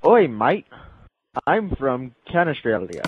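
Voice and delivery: put-on mangled Aussie accent, half-hearted; bad Australian accent. Friend's second try at a bad Australian accent. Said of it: "take two".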